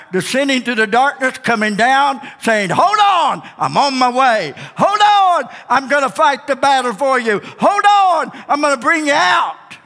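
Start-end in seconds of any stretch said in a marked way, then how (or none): 2.7-9.8 rousing shout. Acted. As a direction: shout hold on like a rescuer on the way. God voiced coming to rescue.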